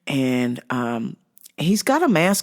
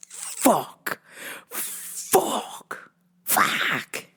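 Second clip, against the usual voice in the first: hushed, drawn-out swearing; mock-despairing whispered expletives; retold dismayed cursing; 3 semitones higher, 7 dB quieter, much slower than usual. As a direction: curse under the breath, word by word. Retold reaction of dread.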